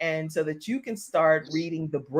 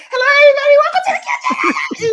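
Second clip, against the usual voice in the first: very high, loud, racing show welcome; hammed-up, over-excited high-pitched host; adrenaline-fueled excitement; 11 dB louder, much faster than usual. Shown as a demonstration. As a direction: squeal the welcome in a rush. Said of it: "the adrenaline is going and everything".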